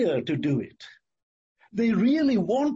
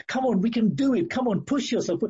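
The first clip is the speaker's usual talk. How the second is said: higher-pitched, eager egging-on; urging; 5 semitones higher, faster than usual. Acted. Heart and mind urge the sick body on, urging. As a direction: push them to keep going, voice raised in pitch. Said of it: "And the heart and the mind".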